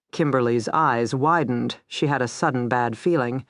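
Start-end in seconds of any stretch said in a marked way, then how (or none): none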